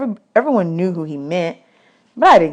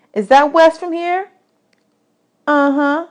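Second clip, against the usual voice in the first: put-on much higher, bossy little girl; 8 semitones higher than usual. Desi quizzing new boys about dishes, high bossy girl voice. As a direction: boss the new boys around.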